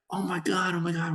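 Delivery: high, excited repeats; thrilled. Retold or imitated. Boys see the tying home run, high excited cry. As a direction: gush with joy. Yankees tie the game.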